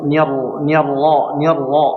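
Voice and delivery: normal talking voice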